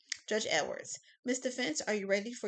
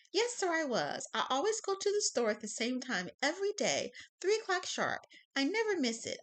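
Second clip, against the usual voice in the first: put-on higher, sweet old woman's voice; kind old lady; 8 semitones higher than usual. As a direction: chirp it like a proud granny. Old lady witness speaks.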